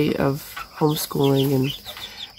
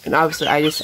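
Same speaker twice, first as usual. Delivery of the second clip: usual speaking voice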